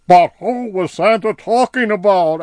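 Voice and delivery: high, loud urgent creature question; High pressing Barnaby, high and loud; High asking. Acted. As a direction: demand an answer. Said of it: "asked High".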